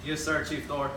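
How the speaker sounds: normal talking voice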